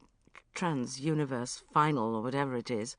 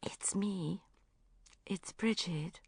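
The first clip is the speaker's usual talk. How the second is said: softer, voiceless and deflated; crestfallen whisper; 7 dB quieter, slower than usual. A narrator's reading. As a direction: whisper it, hurt and small. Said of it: "I whispered".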